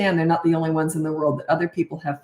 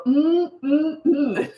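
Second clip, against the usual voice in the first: dad's yummy hum; 7 semitones higher, much slower than usual. Retold or imitated. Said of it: "and he'd go".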